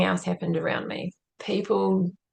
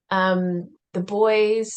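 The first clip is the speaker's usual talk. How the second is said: ordinary talk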